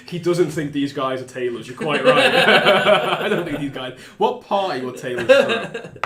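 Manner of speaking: loud, high, laughing through the words; can't keep a straight face. Real, not acted. Game master mocking the tailors cover story, laughing loudly.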